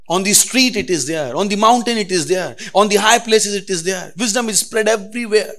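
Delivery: loud, high call on each place; preaching build. Acted. Preacher listing places to the church, loud and rising. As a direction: hammer each place in turn.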